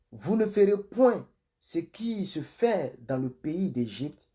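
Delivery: slow, drawn-out, declaimed Bible verses; solemn scripture reading. Acted. Pastor reading God's command to Moses for online viewers, solemn proclamation. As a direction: proclaim each verse deliberately, stretching key words.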